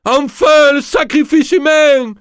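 hurlé, très aigu et fort, lent; hurlant. Acted. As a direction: triomphe sauvage, lame brandie. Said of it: "en hurlant". (Pierre hurle, très aigu et lent)

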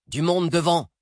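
urgent warning shout. Acted. Passenger warns the driver of people ahead, urgent shout. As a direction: call out the danger ahead.

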